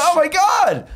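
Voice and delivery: high, loud starstruck squeal; wife squealing at meeting her hero, high and loud. Retold or imitated. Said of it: "She was so".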